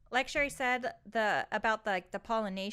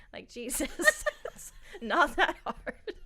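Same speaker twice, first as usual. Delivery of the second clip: laughing; 5 semitones higher, much slower than usual. Real, not acted. Laughing while explaining.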